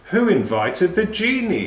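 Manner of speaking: ordinary delivery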